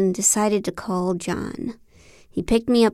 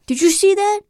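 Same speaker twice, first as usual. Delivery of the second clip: much higher, louder, thrilled; can't believe the shot went in; excited shock; 11 semitones higher, 5 dB louder than usual. Acted. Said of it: "To our collective shock".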